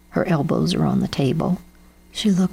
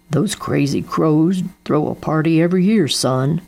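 a hint of a gruff dad; calm fatherly voice, lightly done; father explains to his son; lightly voiced father. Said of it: "said Daddo".

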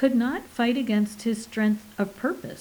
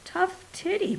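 growl; 9 semitones higher than usual. Acted. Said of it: "Portical growled".

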